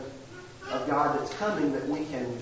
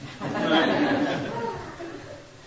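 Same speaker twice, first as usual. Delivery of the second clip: high laugh; 14 semitones higher than usual. Real, not acted. Laughing at own mailbox story.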